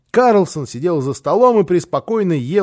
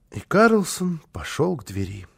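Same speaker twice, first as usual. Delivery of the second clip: usual speaking voice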